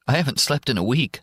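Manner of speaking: tearful. Acted. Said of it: "the sobbing starts again".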